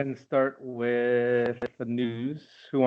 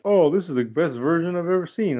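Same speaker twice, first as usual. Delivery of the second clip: higher-pitched imitation of a raving fan; mock gushing; 7 semitones higher, 4 dB louder than usual. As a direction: gush it like a fickle convert. Mimics fickle people suddenly gushing.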